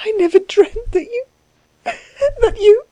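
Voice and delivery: high, tearful, broken-off words; acted tearful woman, pitched very high; weeping. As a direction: weep it out high and halting. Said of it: "she wept".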